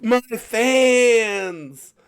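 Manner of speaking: high, loud, showy star calling to fans; loud, high hammy showman voice; hammy Hugh Jackman. Retold or imitated. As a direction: ham it up like a star greeting his fans. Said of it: "he was like".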